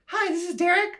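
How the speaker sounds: high squeaky boy voice, mocking; mock thirteen-year-old on the phone; kid voice imitation. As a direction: pitch it way up like a young teen boy. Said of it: "unless this was Derek when we were thirteen".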